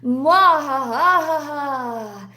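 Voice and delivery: slow evil villain cackle; hammy villain laugh, drawn out; sneering panto villain. Pretend. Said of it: "I will do the splits on stream".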